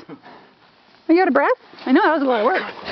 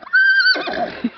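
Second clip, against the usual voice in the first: high giggle. Real, not acted. Owner laughing at horse.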